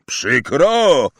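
loud growled echo. Acted. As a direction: growl the word back. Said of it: "warknął Hagrid".

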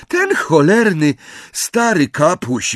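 high, loud, panting outburst; gasping out words. A narrator's reading. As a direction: pant out the curse, loud and high. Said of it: "wydyszał Ron". (zdyszany, głośny wybuch)

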